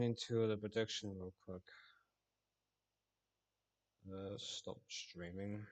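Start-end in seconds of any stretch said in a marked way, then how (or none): none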